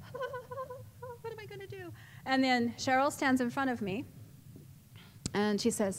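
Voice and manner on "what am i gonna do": tiny, high, very soft voice; scared little squeak; frightened whimper. Retold or imitated. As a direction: whimper it slowly, high and under your breath. Scared self waiting for the fight.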